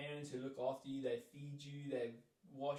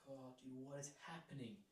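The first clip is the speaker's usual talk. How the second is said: softly, under the breath; hushed retold panic; quiet panicked; 11 dB quieter than usual. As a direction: murmur it to yourself, lost. Panicking alone on arrival.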